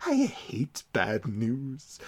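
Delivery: slow villain. Retold or imitated. Villain line.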